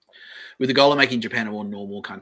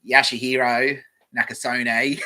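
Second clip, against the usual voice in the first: broad Aussie bogan, drawn out and louder; loud, slow bogan reading of a name; bogan accent; 3 semitones higher, 7 dB louder, slower than usual. Shown as a demonstration. Streamer mocking how a bogan would read it, broad bogan accent. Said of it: "If I read it like a Bogan".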